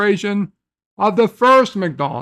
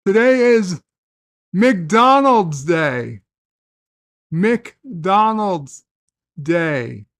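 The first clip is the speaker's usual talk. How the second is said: announcer; much slower than usual. Acted. Announcing the day's theme.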